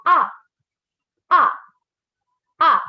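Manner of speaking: "ah" quick, short and sudden; falling fourth tone. Shown as a demonstration. Teacher demonstrates the last tone, short falling "ah". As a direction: drop "ah" abruptly. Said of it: "quick and short and sudden tone".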